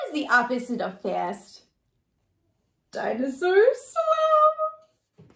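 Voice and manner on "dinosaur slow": very slow and much higher, stretched out. Acted. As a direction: drag it out like a sleepy dinosaur. Reading slow.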